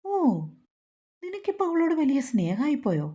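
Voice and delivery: a hint of a furious landlady; raging. A narrator's reading. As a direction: snap back in mock rage. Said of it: "Radha കലിതുള്ളി".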